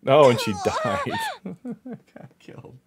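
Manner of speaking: laughing through it, high and slow. Real, not acted. Gamer laughing at dying in game, laughing. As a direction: crack up at your own death.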